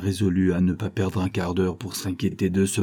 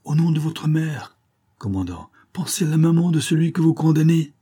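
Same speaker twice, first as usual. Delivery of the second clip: anguished plea; 7 semitones higher than usual. A narrator's reading. Anguished priest invokes the captain's mother: high desperate plea. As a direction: plead for a mother's sake. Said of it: "broyé d'angoisse".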